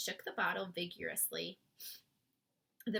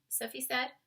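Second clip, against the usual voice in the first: ordinary delivery, nothing marked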